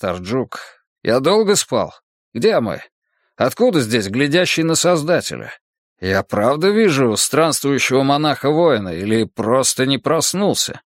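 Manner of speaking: выше, оживлённо и растерянно; только что проснулся, удивлён; голос очнувшегося Бруса. Acted. Брус очнулся после болезни. (оживлённый голос Бруса)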